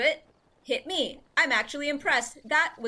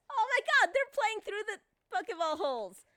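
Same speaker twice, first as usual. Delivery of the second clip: excited; 7 semitones higher than usual. Real, not acted. Thrilled at stream screen.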